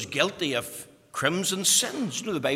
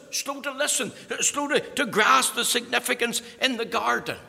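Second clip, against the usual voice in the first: loud, high preaching build; preaching at full force; 9 semitones higher, 6 dB louder than usual. Acted. Preacher thundering at the congregation, loud and high. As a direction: drive the point home to the congregation.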